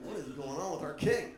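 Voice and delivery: ordinary talk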